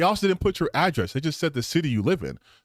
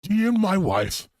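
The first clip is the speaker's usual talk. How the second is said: mock outrage; 2 semitones higher than usual. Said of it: "how angry he's".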